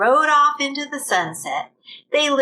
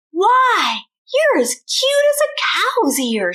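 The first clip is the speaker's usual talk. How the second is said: much higher, gushing sweet voice; smitten delight; 10 semitones higher than usual. Acted. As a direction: swoon over the cute cowboy. Lurleen smitten with Bubba.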